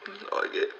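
hammed-up sobbing through the words; mock weeping, struggling to speak; crying. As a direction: caller asked if he is crying, sobbing. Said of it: "[name], ma stai piangendo?".

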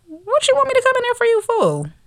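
very high, incredulous squeal of a question. Retold or imitated. Woman balking at being called into a bathroom stall, incredulous. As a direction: shoot way up in pitch, balking at the request.

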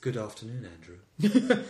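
hushed, low character voice; Blake's cat. Acted. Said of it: "That's also the voice of, uh, of, uh, Blake's cat".

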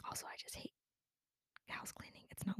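sneak it out in a hushed whisper; a secret whisper to the camera; whispered aside. Real, not acted. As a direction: confess it under your breath, voiceless. Confessing she hates cleaning.

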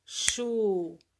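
"Chuvisco" slow, as chu, vis, co; syllable by syllable. Shown as a demonstration. Teacher having pupils count syllables, slowly separating them. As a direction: stretch each syllable and pause between. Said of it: "Como que a gente separa?".

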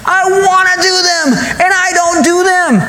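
high, exasperated outcry, drawing out the end; frustrated. Acted. Preacher acts out Paul failing himself, frustrated. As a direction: cry it out in high-pitched exasperation. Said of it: "I feel like he's just frustrated".

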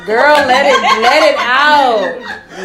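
loud, high laughing cheer; laughing encouragement. Real, not acted. Host cheering her tearful guest on, laughing loudly. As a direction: whoop her on through the laughter.

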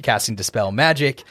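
usual speaking voice

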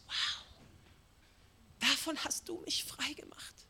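breathless whispered awe; hushed amazed believer; awed whisper. Pretend. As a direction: whisper it in wonder at being freed.

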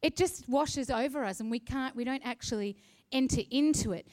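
ordinary delivery

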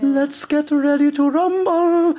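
high, slow ring announcer call; hammed-up fight announcer; announcer boom. Shown as a demonstration. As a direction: stretch it out like a boxing MC. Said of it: "I'd love to hear Jossian".

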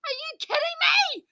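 high, loud exasperated yelling; frustrated shouting. Retold or imitated. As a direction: shout in outrage at the stairs. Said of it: "I'm like".

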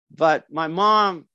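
ordinary delivery

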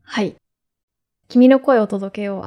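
normal talking voice

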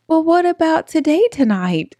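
put-on high, wheedling kid bargaining; little girl voice. Child bargaining with her aunt, high pleading kid voice. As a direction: push for it like a stubborn child. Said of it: "child bargaining on the phone".

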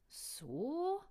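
a high questioning so; musing. Acted. Adrian betrachtet das Gegenmittel, nachdenklich. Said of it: "überlegte Adrian".

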